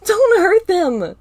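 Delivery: high, loud, slow desperate cry; desperate scream. A narrator's reading. Star screams to protect her friends, desperate scream. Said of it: "Star screamed".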